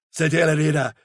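plain reading voice

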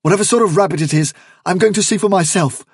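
fast, loud, high and urgent; hurried, strained determination; panting resolve. A narrator's reading. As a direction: rush it out bravely despite fear. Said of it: "he said, panting".

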